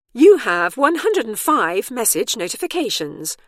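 high, loud device announcer; tablet announcement. A narrator's reading. Tablet reports waiting messages to Bernice, announcing. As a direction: announce it loudly like a talking tablet. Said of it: "announced the tablet".

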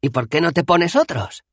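pregunta animada, mucho más aguda; animado. A narrator's reading. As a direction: Dos Flores propone una solución entusiasmado. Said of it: "preguntó Dos Flores con animación". (con animación, agudo)

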